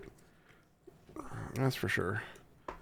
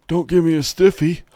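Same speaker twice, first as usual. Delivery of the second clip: higher, louder put-on joking voice; mock voice; 7 semitones higher, 8 dB louder than usual. Host joking to viewers about the stiff cable, in a silly higher voice. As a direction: camp it up in a silly voice.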